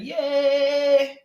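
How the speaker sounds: high, drawn-out celebratory yay; excited cheer. Real, not acted. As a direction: cheer happily for a player. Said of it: "I'm excited for Sunshine".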